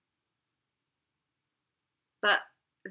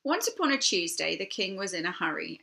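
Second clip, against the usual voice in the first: ordinary delivery, nothing marked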